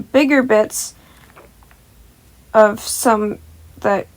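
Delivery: ordinary delivery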